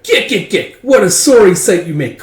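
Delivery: put-on high villain voice; cackling villain taunt. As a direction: jeer at a beaten foe.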